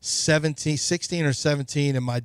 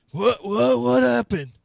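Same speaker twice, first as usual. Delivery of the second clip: high mock confusion, pretending to just wake up; fake waking up; 9 semitones higher, slower than usual. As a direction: play dumb like you were fast asleep. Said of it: "I had to do the fake what".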